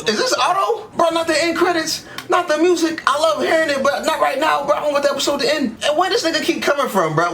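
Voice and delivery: high-pitched, agitated pleading; frantic protest. Real, not acted. Viewer begs the episode not to end, high-pitched frantic protest. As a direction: protest urgently, voice jumping way up.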